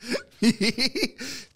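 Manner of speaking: high-pitched giggling laugh. Real, not acted. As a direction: run of high chuckles tailing down. Laughs at own remark.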